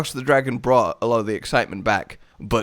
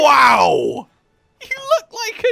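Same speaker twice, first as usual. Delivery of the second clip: loud, high, astonished shout; amazed outburst; 11 semitones higher, 5 dB louder, slower than usual. Real, not acted. Player seeing the new armor set, amazed. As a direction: let out a big awed yell.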